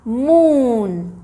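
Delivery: मून with the "oo" greatly drawn out; long ऊ in मून. Shown as a demonstration. Teacher giving a common spelling of long ऊ, मून heavily elongated. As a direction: stretch the vowel of मून very slowly. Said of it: "long vowel sound में है ऊ".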